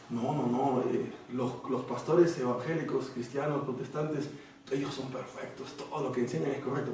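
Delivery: softly voiced mock denial; mock defence. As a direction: brush it off in a low, soft voice. Voices a hypothetical blind defence.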